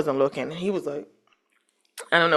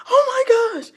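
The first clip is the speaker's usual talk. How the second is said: high gasping oh my gosh; imitating a shocked bystander; startled stranger. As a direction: mimic the shocked lady. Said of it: "she was like".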